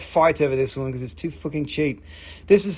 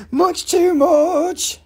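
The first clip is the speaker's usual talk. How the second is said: mock shock; 11 semitones higher, 6 dB louder, much slower than usual.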